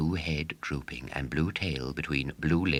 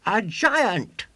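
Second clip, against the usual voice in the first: put-on high, loud boastful showman boy; 9 dB louder than usual. A narrator's reading. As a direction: crow it like a swaggering boy showman. William announces exhibit.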